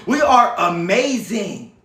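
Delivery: slow, laughing through the words. Real, not acted. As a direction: let the laugh carry the line. Live talk, laughing repeat.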